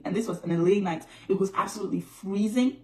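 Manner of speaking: ordinary talk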